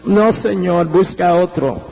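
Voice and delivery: low retold plea, lightly tearful; tearful refusal. Preacher retells crying before the Lord, a low tearful refusal. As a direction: plead low, dropping away at the end. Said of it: "pero llorando".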